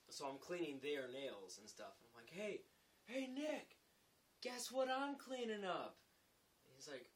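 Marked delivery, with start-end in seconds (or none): 2.3-6.0 high, loud goading; teasing call-out. Retold or imitated. Laborer cleaning up after carpenters, teasing call. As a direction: call over to bait him. Calling to carpenter to tease him.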